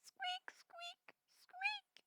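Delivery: high, thin little squeaks; tiny squeak. A narrator's reading. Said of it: "out came the tiniest squeak".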